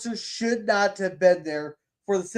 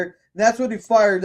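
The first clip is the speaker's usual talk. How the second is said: ordinary talk, nothing marked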